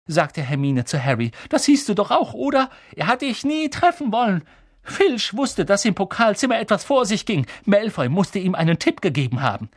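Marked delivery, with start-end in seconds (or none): 1.5-9.7 high, insistent, heated explaining; agitated argument. A narrator's reading. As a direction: press the point urgently in a raised pitch. Hermine heatedly argues with Harry.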